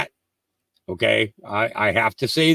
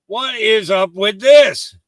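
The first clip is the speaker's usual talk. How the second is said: slow, incredulous; 7 semitones higher, 4 dB louder, slower than usual. Shown as a demonstration. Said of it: "repeat with other take".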